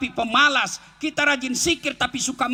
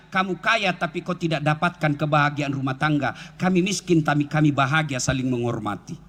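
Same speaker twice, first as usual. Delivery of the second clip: lower, calmly proud reply; lower, lightly voiced proud reply; a hint of proud retort; 10 semitones lower than usual. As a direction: tell the rich man kindly but firmly. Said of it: "kita bisa bilang".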